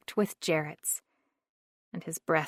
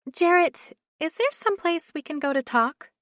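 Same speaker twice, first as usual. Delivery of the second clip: higher and louder woman's voice; Carly's voice; 10 semitones higher, 6 dB louder than usual. A narrator's reading. Carly asking Jarrett somewhere private to talk, higher voice. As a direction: call him in a raised, higher voice.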